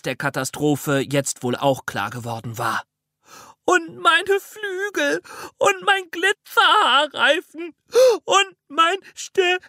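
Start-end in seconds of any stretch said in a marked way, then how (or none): 3.6-9.6 put-on very high child wail breaking into sobs; wild sobbing. A narrator's reading. Jana listing her lost things, wailing and sobbing. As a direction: shriek through gulping sobs, last word broken apart. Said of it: "zwischen wilden Schluchzern aus ihrem Mund".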